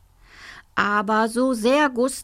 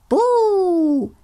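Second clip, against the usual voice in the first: a much higher, loud ghostly "Buh" shriek; shouted boo; 11 semitones higher, 5 dB louder than usual. Acted. Little ghost Annabelle tries the scare, shrieking loud. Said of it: "schrie Annabelle".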